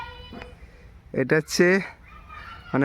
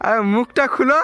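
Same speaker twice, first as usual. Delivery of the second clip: loud, much higher call across distance; 11 semitones higher, 7 dB louder than usual. Real, not acted. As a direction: shout it out to be heard. Calling out to someone nearby.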